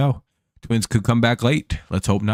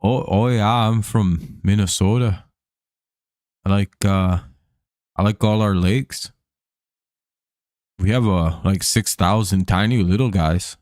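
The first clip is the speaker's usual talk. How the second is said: hammed-up Minnesota accent, long round O's; broad mock-Minnesota voice; Minnesota impersonation. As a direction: do a folksy Minnesotan, unhurried. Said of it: "do you guys like my Minnesota, uh, impersonation".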